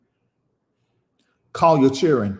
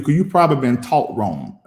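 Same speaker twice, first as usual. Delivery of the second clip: ordinary talk, nothing marked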